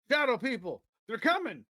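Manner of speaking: high-pitched wild prospector outburst; exclaiming. A narrator's reading. Crazed prospector Jesse warns the saloon, exclaiming. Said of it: "he exclaims".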